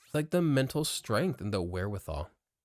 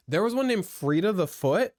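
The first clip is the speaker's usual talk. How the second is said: excited; 7 semitones higher, 8 dB louder than usual. Real, not acted. Gleeful recall of kids show.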